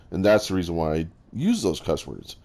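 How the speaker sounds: ordinary talk, nothing marked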